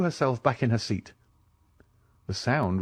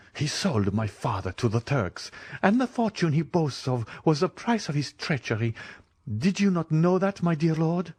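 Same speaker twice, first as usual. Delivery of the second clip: angry; 7 semitones higher than usual. Acted. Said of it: "her eyes flashing with rage".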